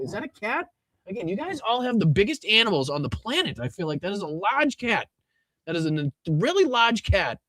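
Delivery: high-pitched, incredulous gushing; amused amazement. Real, not acted. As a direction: marvel in a high, swooping voice. Amazed at a listener's cat.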